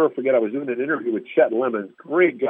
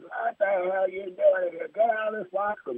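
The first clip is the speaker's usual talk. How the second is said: high mock yell across the room; angry player screaming at a reporter to get out; retold scream; 8 semitones higher, 5 dB quieter than usual. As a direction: yell him out of the locker room. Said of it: "He's screaming".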